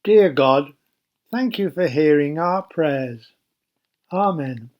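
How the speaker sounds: ordinary delivery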